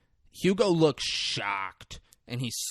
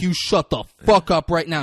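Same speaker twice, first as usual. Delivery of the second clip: loud, raised-pitch command; mock angry order; 4 semitones higher, 9 dB louder than usual. [name] silences Emily, mock angry order. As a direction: order her to silence, much louder.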